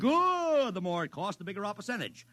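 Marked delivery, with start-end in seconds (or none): 0.0-0.7 sudden high loud delighted "Good."; gleeful good. Acted. Publicist delighted that the campaign costs a fortune, loud glee. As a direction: bark it with delight as the costs pile up. Ad man cheering rising costs.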